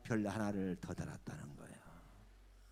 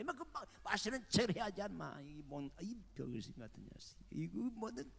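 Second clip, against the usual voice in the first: shrill insults building to a yell at the general; furious berating; 7 semitones higher than usual. Retold or imitated. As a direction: tear into him like a drunk elder. Elder berates general in anger.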